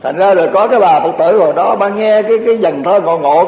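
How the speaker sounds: ordinary talk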